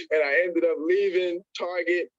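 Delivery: usual speaking voice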